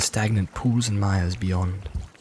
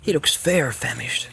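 Sam voice. Acted. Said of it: "thought Sam".

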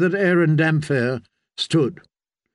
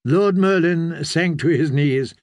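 ordinary delivery

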